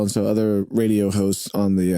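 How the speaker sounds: ordinary talk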